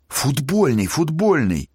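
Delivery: high excited repetition. Acted. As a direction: blurt it out eagerly. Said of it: "нёс белиберду студент".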